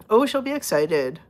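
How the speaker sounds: usual speaking voice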